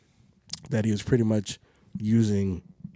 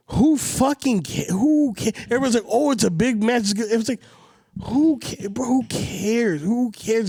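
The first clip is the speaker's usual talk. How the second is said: exasperated; 7 semitones higher than usual. Real, not acted. Ranting about the boring match.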